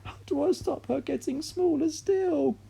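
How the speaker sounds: very high, strained desperate voice; mother holding tiny grandma; desperate cry. A narrator's reading. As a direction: cry out helplessly.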